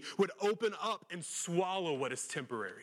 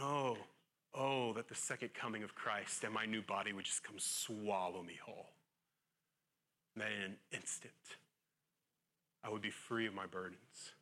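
longing; 7 semitones lower, 6 dB quieter than usual. Retold or imitated. Paul's longing voiced in prayer.

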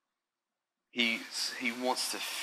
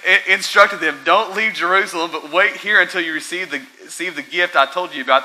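much louder and higher, fired-up preaching; preaching at full force; 8 semitones higher, 12 dB louder than usual. Acted. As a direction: pound it out from the pulpit.

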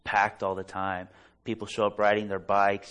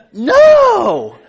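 high, loud shriek of refusal; hammed-up panicked scream; freaking out; 22 dB louder than usual. Woman refusing a stranger's hand on her leg, freaked-out scream. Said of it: "She's freaking out".

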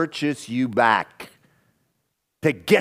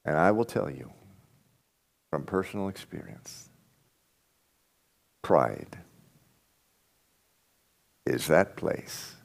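quiet confession; 8 semitones lower, 6 dB quieter than usual. Real, not acted. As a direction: confide it like a personal admission. Pastor confessing personal pride.

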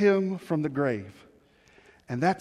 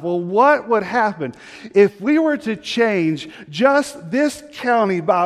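louder, ringing pulpit voice, pitched higher; preaching at full force; 5 semitones higher, 5 dB louder than usual. Acted. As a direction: drive the challenge home to the congregation. Preaches the one percent vision.

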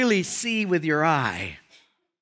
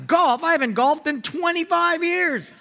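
pitched way up, indignant golf outburst; excited disbelief; 10 semitones higher than usual. Acted. Homeless man scoffs at golf, high excited. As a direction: leap up high, shocked anyone asks.

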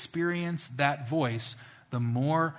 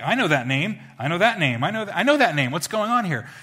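louder from the start, climbing higher with each repeat; the name dawning on you, more amazed each time; growing recognition; 6 semitones higher, 8 dB louder than usual. Retold or imitated. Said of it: "I'm like".

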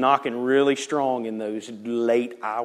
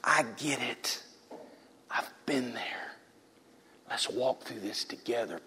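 tender invitation; 4 semitones lower, 9 dB quieter than usual. Acted. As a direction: invite them in softly, with long pauses. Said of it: "stands like this and says".

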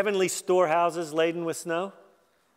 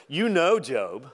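sarcastic; 4 dB louder, slower than usual. Acted. God taunting Job about his birth, sarcastic. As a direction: lean hard on "You know" with mock deference. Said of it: "if God was sarcastic".

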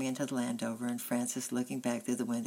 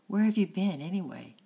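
angry demand; 6 semitones higher, 6 dB louder than usual. A narrator's reading. Mother scolding returned son.

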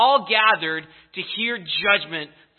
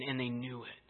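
usual speaking voice

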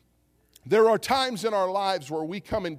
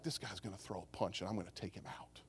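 very low, hushed private thought; acted under-the-breath resolve; inner thought; 10 semitones lower, 19 dB quieter than usual. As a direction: mutter it deep and quiet to yourself. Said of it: "in my mind, like, the carnal side of me".

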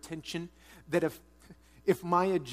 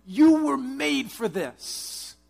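slow, much higher, much louder proclamation; preaching at full force; 8 semitones higher, 9 dB louder, much slower than usual. Real, not acted. Preacher urging the congregation, slow loud declaration. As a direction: drive each word home, slow and strong. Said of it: "I'm telling you".

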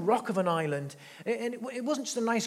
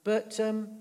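ordinary delivery

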